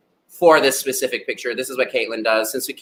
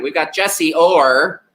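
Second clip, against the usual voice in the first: ordinary delivery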